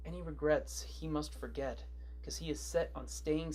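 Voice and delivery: low, subdued gloom; a little emo. Acted. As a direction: narrate it quietly, sinking into mock melancholy. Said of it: "is now a little emo".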